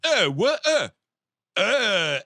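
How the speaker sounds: high, loud groggy grunts; mock sleepy startled noises; startled waking grunts. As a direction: imitate jolting awake confused. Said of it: "he wakes up like".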